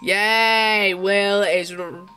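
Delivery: normal talking voice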